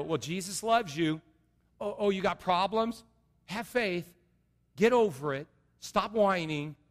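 mock glib pastor brushing people off; dismissive preacher. Pastor mocking a callous sermon, dismissive. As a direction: toss it off like a careless preacher. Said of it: "go up here and go".

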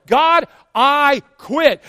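hammed-up weary surrender, slow and pleading; drawn-out mock surrender. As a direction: stretch it out like a tired churchgoer throwing in the towel. Churchgoers giving up to God.

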